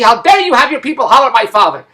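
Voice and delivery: retold hollering. Daughter phoning the agency about their staff, mock-outraged. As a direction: imitate an indignant telling-off. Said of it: "they hollered at the agency".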